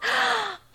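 sharp gasp. Acted. Sudden reaction during intimate scene.

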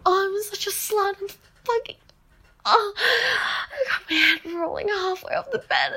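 usual speaking voice

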